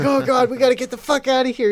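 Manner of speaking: high, fast, loud panic; panicked. Retold or imitated. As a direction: blurt it out in a frantic hurry. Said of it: "It's like".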